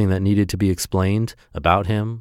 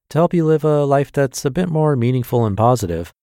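podcast intro; 5 semitones higher than usual. Acted.

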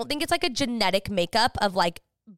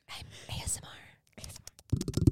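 slow, voiceless ASMR whisper; 13 dB quieter, much slower than usual. Shown as a demonstration. Podcast host showing off her new quiet voice, whispering. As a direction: whisper slowly, very softly into the mic. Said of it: "So I'm whispering".

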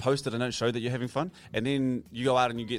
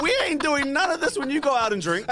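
laughing exasperated protest; 14 semitones higher than usual. Retold or imitated. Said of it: "I'm like".

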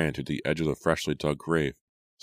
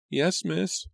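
timid maid. A narrator's reading. Maid answers avoiding gaze.

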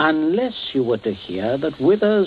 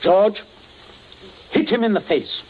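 sharp raised command, pitched up high; barked order; 8 semitones higher than usual. Acted. Captain ordering George to hit Smollett, commanding shout. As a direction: order the thug to strike, no hesitation.

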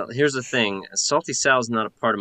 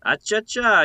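playful; 12 semitones higher, 3 dB louder than usual. Acted. Playful exclamation.

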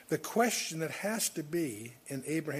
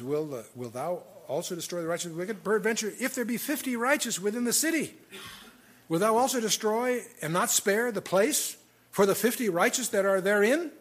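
measured, solemn Bible reading, a touch louder; scripture reading; 4 semitones higher, 4 dB louder than usual. Acted. Preacher reading Genesis to his congregation, solemn scripture reading. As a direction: read the verses out with weight. Reads Genesis aloud in sermon.

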